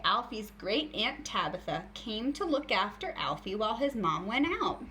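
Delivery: ordinary talk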